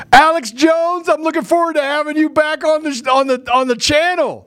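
high, hammed-up announcer voice; mock host welcome. Host mockingly welcomes Alex Jones back, in a high announcer voice. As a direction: play a gushing show host.

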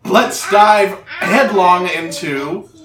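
slow, grand show opener; old radio drama narrator. Acted. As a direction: announce it like a show. Opens the campaign like a show.

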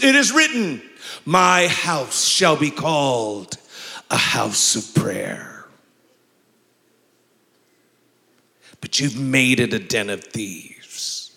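slow, measured Jesus voice; the way he was taught. Shown as a demonstration. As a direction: deliver it with grave authority, stretching each phrase. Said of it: "I had to say it like this".

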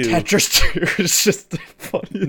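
words breaking into laughter; laughing. Real, not acted. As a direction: laugh through the line. Cracking up at Tetris two.

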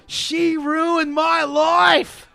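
very slow, high wailing shout; hammed-up Australian tantrum; screaming Australian kid. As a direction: wail it like a furious Aussie boy. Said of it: "He's screaming".

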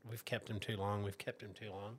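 quiet. Retold or imitated. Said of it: "I kept thinking".

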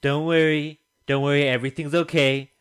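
loud, high mock Trump voice; Trump impression. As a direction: boom it like Trump reassuring. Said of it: "there's a clip of Trump saying".

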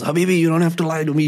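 put-on fast, high, loud Arabic accent. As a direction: imitate a Lebanese cabbie's Arabic accent. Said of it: "he had a thick Arabic accent".

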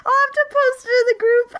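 high, slow words through laughter; real laughter squeezing the voice; laughing. Real, not acted. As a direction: still cracking up. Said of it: "it's so funny".

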